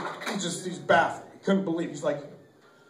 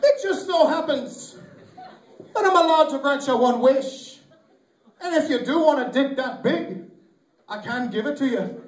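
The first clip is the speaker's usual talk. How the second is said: cartoon leprechaun making an offer; 6 semitones higher than usual. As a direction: play a cocky little leprechaun, pitched up. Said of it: "So the leprechaun looks at him".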